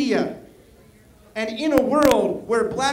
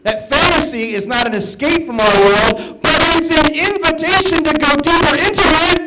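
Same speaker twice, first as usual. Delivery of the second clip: rousing speech, louder, rising higher on the end; like I have a dream; 5 semitones higher, 6 dB louder than usual. Shown as a demonstration. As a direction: deliver it like a stirring civil rights sermon. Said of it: "treat it like I have a dream".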